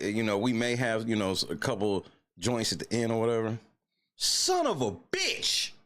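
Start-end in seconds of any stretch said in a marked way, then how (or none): 4.2-5.7 high, slow, pained curse drawn out; anguished curse. Real, not acted. Fan groaning at a lost game, high and slow. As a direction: wail it out in disbelief.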